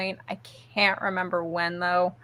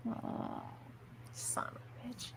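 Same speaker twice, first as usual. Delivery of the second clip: very soft, voiceless whisper; muttered mock curse; 3 semitones higher, 20 dB quieter, slower than usual. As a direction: curse him under your breath, acting it out. Retold reaction to boyfriend's excuse.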